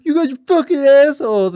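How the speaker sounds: high, loud furious yell; angry shout. Retold or imitated. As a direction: scream it at them in rage. Girlfriend furious at roommates.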